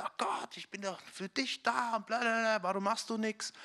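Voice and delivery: gespielt nörgelnd, höher gesetzt; meckernd. Retold or imitated. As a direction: jammernd Gott vorwerfen, halb ironisch. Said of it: "ich fange an zu meckern und sage". (ein Christ meckert Gott an, gespielt nörgelnd und höher)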